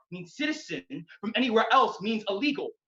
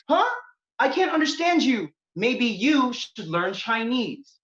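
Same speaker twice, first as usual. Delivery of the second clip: mother's voice; 5 semitones higher, 4 dB louder, slower than usual. Retold or imitated. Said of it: "She replied".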